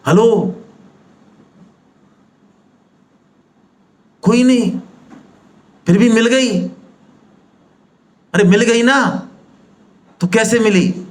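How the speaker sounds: high-pitched calling out for an answer; prodding the audience. Real, not acted. Speaker questions seminar audience on how they got it, insistent prodding. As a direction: press a silent room to respond.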